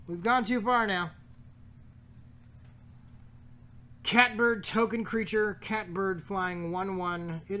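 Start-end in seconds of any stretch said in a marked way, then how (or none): 0.1-5.6 loud incredulous exclamation; mock outrage. Real, not acted. Reacting to a catbird token with loud mock disbelief. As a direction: act scandalised by the silly card.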